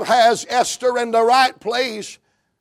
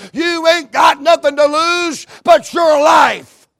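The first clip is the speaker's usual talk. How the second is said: louder and louder urgent exhortation; preaching at full force; 5 semitones higher, 4 dB louder than usual. Acted. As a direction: drive it home like a revival preacher. Mordecai urging, building to shouts.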